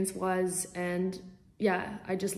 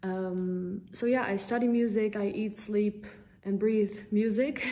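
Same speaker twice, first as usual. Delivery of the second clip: ordinary talk, nothing marked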